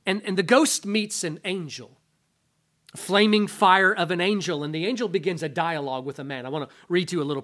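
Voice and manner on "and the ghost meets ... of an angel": usual speaking voice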